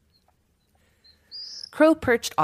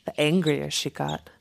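plain reading voice

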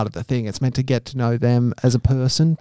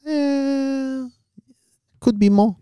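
put-on Chinese accent; 11 semitones higher than usual. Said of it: "doing an accent".

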